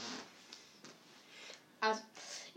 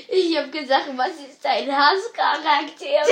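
too loud, headphones on; 8 dB louder than usual. Real, not acted. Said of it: "Was hast du gesagt?".

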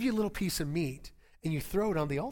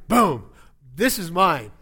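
mock loud claim; 10 dB louder, slower than usual. Mock claiming the fried chicken.

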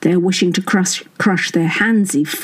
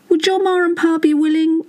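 called out, pitched higher, as Potty; loud suggestion; 10 semitones higher than usual. A narrator's reading. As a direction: call it out from the spare room. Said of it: "suggesting in a loud voice".

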